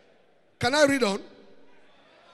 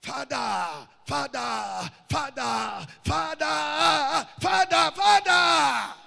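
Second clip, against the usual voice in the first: building prayer cry; 2 semitones higher, much slower than usual. Shown as a demonstration. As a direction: start hushed, build to a crying shout. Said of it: "Can you see how easy prayer is?".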